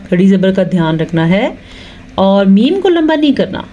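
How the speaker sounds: usual speaking voice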